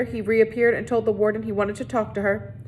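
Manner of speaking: ordinary delivery, nothing marked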